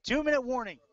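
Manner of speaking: loud, high stadium announcement; announcer call. Real, not acted. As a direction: belt it out to the whole stadium. Announcer calls the two-minute warning.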